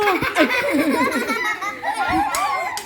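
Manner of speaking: long high giggle that falls away; high laugh. Real, not acted. Child laughs at story.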